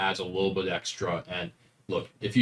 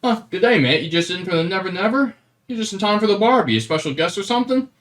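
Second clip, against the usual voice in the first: high, loud mock-Australian dwarf; Australian accent; 12 semitones higher, 8 dB louder, faster than usual. Dwarf greeting players expecting Scottish, Australian accent. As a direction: greet them in hammed-up Australian, getting faster. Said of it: "looked up a bunch of Australian slang".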